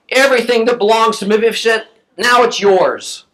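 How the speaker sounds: mildly angry king's pronouncement. Acted. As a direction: pronounce it as a displeased king, slowing on the last words. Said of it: "Now the king's angry".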